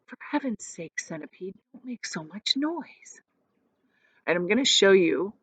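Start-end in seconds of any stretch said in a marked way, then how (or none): 0.1-3.2 soft, hushed scolding; softly. A narrator's reading. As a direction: plead quietly not to be heard. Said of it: "James said softly".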